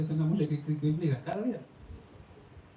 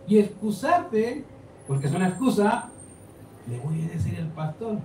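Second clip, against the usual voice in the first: higher, pleading preacher's appeal; sermon at full force, higher and urgent; impassioned preaching; 5 semitones higher than usual. Real, not acted. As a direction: press the congregation, rising in fervour. Preaching climax, raised pitch.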